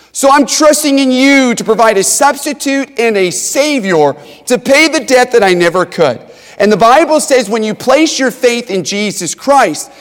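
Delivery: loud, high, fervent preaching; preaching at full force. Acted. Preacher rousing the congregation about forgiveness, loud fervent preaching. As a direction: drive every line home like a revival preacher.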